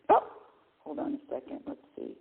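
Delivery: ordinary talk, nothing marked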